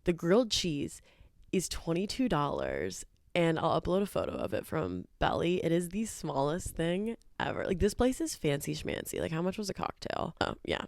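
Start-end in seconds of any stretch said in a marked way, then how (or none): none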